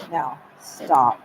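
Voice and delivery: loud, firm stop; sharp command. Retold or imitated. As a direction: bark the order to stop. Stan's retold command.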